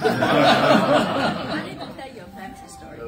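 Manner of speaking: loud laughing protest, slowed; a guest laughing as she claims her turn. Real, not acted. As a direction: laugh it out at full volume. Laughing interjection to host.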